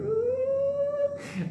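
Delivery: a tiny, very high scared squeak; high frightened squeal. Retold or imitated. Scared in tent like little.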